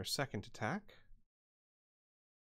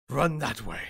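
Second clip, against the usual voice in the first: louder, forceful order; booming command; 2 semitones higher, 7 dB louder than usual. Acted. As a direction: boom it out as a command. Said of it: "In a booming voice".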